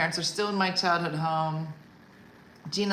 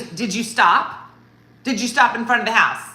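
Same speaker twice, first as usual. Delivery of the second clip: louder, higher pressing demand; aggressive mom; 5 semitones higher, 9 dB louder than usual. Retold or imitated. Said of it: "kinda aggressive".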